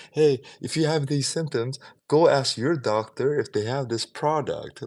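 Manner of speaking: a hint of a TV commercial announcer; mock ad voice. Mocking drug commercial.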